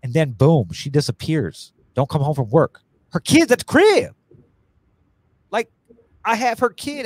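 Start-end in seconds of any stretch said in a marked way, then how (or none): 3.1-7.0 outraged. Real, not acted. Outraged at being left with kid.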